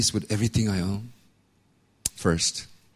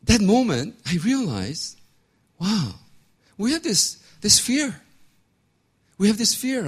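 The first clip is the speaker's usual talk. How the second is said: much higher, wonder-struck preaching; awed realization; 12 semitones higher, 3 dB louder than usual. Real, not acted. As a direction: lift it up in awe.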